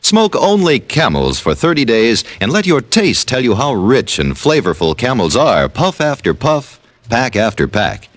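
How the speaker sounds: ordinary delivery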